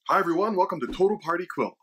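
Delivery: ordinary delivery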